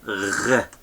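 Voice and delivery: "R" as a throaty, rolling "gh"; gargled back-of-throat "R"; letter sound said alone; soft Gamal sound. Shown as a demonstration. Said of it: "sounds is".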